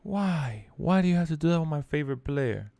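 high, plaintive pleading; pained lament. Real, not acted. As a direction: plead "why" like a heartbroken fan. Lamenting the loss to the game.

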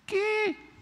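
high wife's reply from far off; wife answering. Retold or imitated. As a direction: answer like a startled wife from another room.

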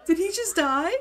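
loud, high, laughing shock; shocked disbelief. Real, not acted. Player watching a sim suddenly die, shocked disbelief. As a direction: blurt it out high, half laughing.

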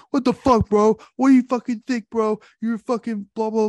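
high, loud mock angry yelling; Matt Smith getting in his face. Grown man yelling up close at a guy who mocked his band, high and loud. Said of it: "gets in his face like this close".

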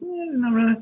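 lightly voiced unsure actress. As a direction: shrug it off, voice lifted. Actress answering in retold session.

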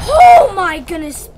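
loud, high, drawn-out exclamation; shocked outburst. Real, not acted. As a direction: blurt it out in shock, stretching the start. Shocked by sudden game event.

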